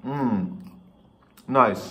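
speaking with food in mouth; mumbling through a bite; chewing while talking. Real, not acted. Seen 0: Bit bun at -5; mouth still working food early, bun held.

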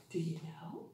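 put-on soft whisper to the children; whispered question. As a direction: lean in and whisper it. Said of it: "teacher whispers to class".

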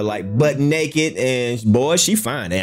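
ordinary talk, nothing marked

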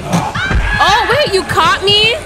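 high, loud shocked cry; high startled shout, slowing down; shocked shriek. Real, not acted. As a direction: yelp in disbelief at being caught. Caught by the killer, shrieking.

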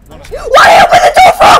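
high fast panicked shout; being chased. Real, not acted. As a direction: shriek in panic.

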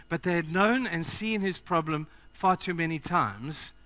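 ordinary delivery

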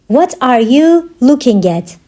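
measured, word-grouped language-lesson delivery. Shown as a demonstration. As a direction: break it up clearly for learners. Teacher repeats question slowly for learners.

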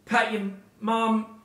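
slow, high-pitched reminder; drawn-out instruction. Pretend. Host listing sign-off chores for the audience, slow and drawn out. First take, in sign-off list.

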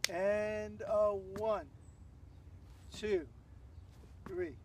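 stretched-out "And a one", then spaced beats; drawn-out, deliberate count-in; slow count. Shown as a demonstration. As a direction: count the dance step in slow motion. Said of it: "I'll do that again a little slowly".

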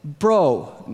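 indignant call-out; arguing protest. Acted. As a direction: cut in to scold a fellow criminal. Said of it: "protested and started arguing with that criminal".

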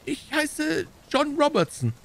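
hohe Stimme, langsam und ausweichend; zögernd. A narrator's reading. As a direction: den falschen Namen zögerlich vorbringen. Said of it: "Der Bursche zögerte einen Augenblick". (zaudernder kleiner Bursche)

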